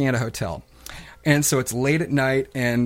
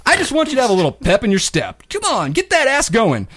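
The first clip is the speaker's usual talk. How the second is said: pushy cheery clerk; 9 semitones higher, 3 dB louder than usual. Retold or imitated. Clerk coaxing guest out of bed in relentless cheer. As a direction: cheer him on with fake pep. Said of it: "he's still in that same voice".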